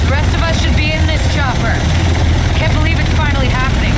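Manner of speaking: shouting over noise. Acted. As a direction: call out to be heard over rotors. Shouting aboard the loud chopper.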